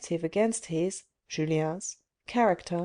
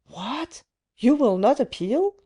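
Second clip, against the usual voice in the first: higher and louder, outraged at his refusal; enraged disbelief; 5 semitones higher, 5 dB louder than usual. A narrator's reading. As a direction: hurl the question back at him in fury. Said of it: "with her eyes sparkling with rage".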